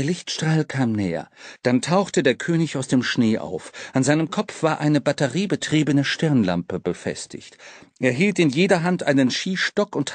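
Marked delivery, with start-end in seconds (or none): none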